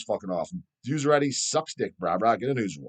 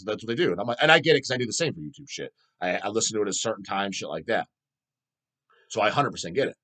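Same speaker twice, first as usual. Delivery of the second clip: normal talking voice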